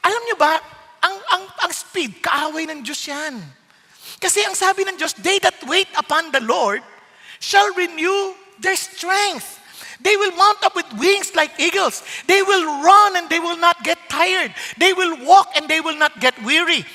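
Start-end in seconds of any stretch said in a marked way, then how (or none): 5.2-16.9 scripture recital. Acted. Quoting scripture with emphasis.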